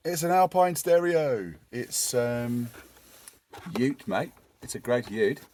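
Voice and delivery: name the stereo like an Aussie bloke; Australian accent. Pretend. Vlogger naming his stereo to viewers in an Australian accent. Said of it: "putting an Australian accent on".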